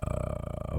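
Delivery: very low, slow, soft breathy murmur; mock ASMR murmur, low and slow. Shown as a demonstration. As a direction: hush right down into the mic like ASMR. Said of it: "was it A S M R".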